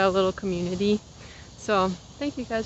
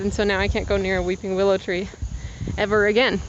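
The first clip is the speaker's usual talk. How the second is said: ordinary talk, nothing marked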